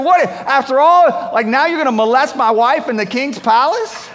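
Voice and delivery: a king catching him in the act; scandalized royal outburst; outraged king. Retold or imitated. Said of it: "That's what he says to him".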